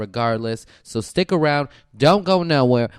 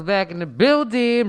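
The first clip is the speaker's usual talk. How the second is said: slower, drawn out hype; 10 semitones higher, 5 dB louder, slower than usual. Acted. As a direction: stretch it out like a host. Repeated hype phrase.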